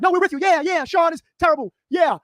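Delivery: high, loud mock-agreeable official; sarcastic impression of the sheriff's office, high and loud. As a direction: ham up a fake-friendly sheriff's office. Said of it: "the sheriff's office is like".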